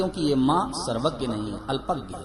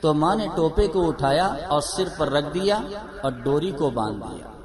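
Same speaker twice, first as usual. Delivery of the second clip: ordinary talk, nothing marked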